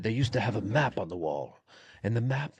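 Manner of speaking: lightly voiced wise old man telling a story; old man's patient storytelling voice; old man storyteller voice. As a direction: recount it as a slightly condescending old-timer. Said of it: "I did it in my voice".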